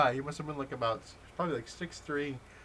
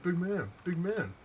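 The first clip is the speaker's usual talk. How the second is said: higher-pitched mocking imitation of a bully; mock taunting; 6 semitones higher than usual. Gunman gloats at storyteller after taking his gun, taunting. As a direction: crow it like a cocky gunman.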